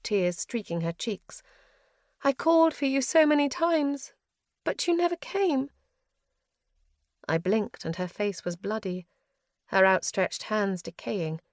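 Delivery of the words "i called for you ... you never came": high, tearful longing; tearful mother. A narrator's reading. Mother's ghost weeping to her daughter, high and tearful. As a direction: breathe it through tears.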